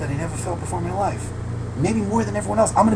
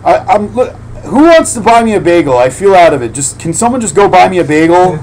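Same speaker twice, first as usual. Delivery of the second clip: louder, calling out for a bagel in the street; loud beggar; 3 semitones higher, 8 dB louder than usual. Retold or imitated. Saint calling to passersby, hammed-up loud plea. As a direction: shout to the street for someone to buy a bagel. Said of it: "he's like".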